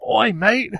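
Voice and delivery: high, loud Cockney call; hardcore mock Cockney. Fake Doctor hailing a mate, hardcore mock Cockney. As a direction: hail someone in hammed-up Cockney. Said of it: "hardcore Cockney".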